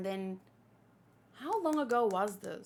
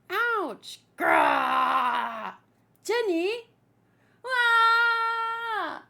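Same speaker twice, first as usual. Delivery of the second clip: high yelps and a growled shout; hammed-up hurt and anger; pained cries; 8 semitones higher, 4 dB louder, much slower than usual. Kids fighting, streamer voicing yelps and shouts.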